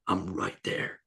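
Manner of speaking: mock quiet mutter after a loss; under the breath, "I'm right there" kept low; muttered to himself. [name] to his friend after the match, quiet mutter.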